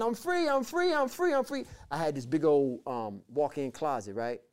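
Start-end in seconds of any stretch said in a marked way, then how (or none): 0.0-1.6 very high, repeated cry of freedom; retold tearful rejoicing. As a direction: cry it out high, overcome with joy. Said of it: "I started crying, saying".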